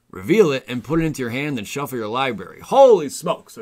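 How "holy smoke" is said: high loud amazed exclamation; surprised. Real, not acted. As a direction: gasp it out in amazement. Reacting to card text.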